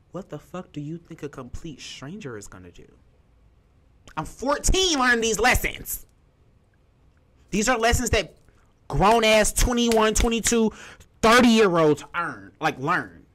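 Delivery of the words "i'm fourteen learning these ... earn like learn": much higher and louder, emphatic; impassioned outburst. Real, not acted. Telling viewers what she learned at fourteen, loud and emphatic. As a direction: drive the point home, raging. Outraged about lessons learned young.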